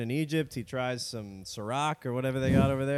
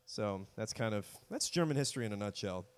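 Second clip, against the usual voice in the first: ordinary talk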